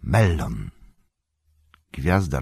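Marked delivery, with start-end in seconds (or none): 0.0-0.7 Mellon spoken low and emphatic, every syllable clear; said firmly. A narrator's reading. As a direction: state Mellon like a key turning. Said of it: "dobitnie powiedział".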